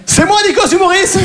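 high, laughing shout of his own name; mock outrage, shouted through laughter; indignant shout.

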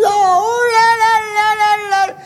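high, long run of wailing syllables; wailing lament. Retold or imitated. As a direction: wail it out in hammed-up dismay. Commotion as police bring her down.

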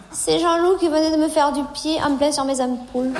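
ordinary delivery

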